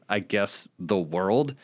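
ordinary delivery

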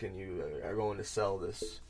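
ordinary talk, nothing marked

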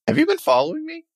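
high-pitched startled question. Acted. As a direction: jump in surprise, voice shooting up. Startled by Vlad appearing behind.

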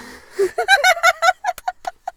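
high, loud burst of laughter; real high-pitched guffaw; big laugh. Real, not acted. Reaction to joke about documentaries.

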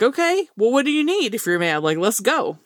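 high, eager, accommodating reply; eager helper. Pretend. A coworker answering the mad button, eagerly helpful. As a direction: leap in to help right away.

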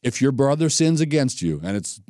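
usual speaking voice

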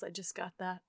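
low quiet I just got that; quiet aside. Real, not acted. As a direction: murmur I just got that to yourself. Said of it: "repeats as whisper".

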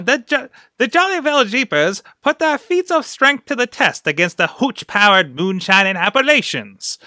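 high, bold vintage newsreel announcer; old-timey announcer voice. Acted. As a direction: bark it like a 1920s fight promoter. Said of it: "read that voice in your head".

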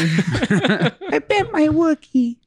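slow, very high silly voice; high cartoon voice. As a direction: squeak it out like a cartoon kid. Simpsons quote in funny voice.

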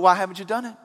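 ordinary talk, nothing marked

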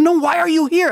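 high, insistent mimicked woman's voice; Italian lady. Italian lady questions the pilgrimage leader, high urgent imitation. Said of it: "I can't do the Italian accent".

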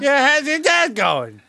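put-on high, slow Turkish accent through laughter; mock Turkish accent. Repeating the coworker's greeting in a laughing Turkish accent.

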